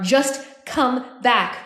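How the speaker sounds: beg, leaning on every word; pleading. A narrator's reading. Woman begging her sister to return, pleading. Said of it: "Blue pleaded with her sister".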